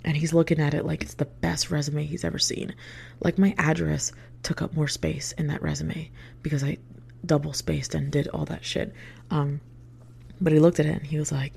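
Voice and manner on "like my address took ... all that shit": plain reading voice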